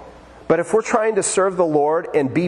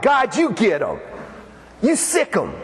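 higher-pitched, play-acted plea for payback; mock vengeful prayer; 6 semitones higher than usual. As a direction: egg God on, hammed-up.

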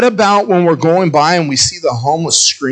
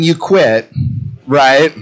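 ordinary talk, nothing marked

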